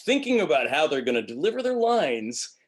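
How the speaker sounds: high, loud, over-melodic delivery; mock an actor planning each note; exaggerated singsong bad acting; self-conscious line delivery. Shown as a demonstration. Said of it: "is clearly psychotic".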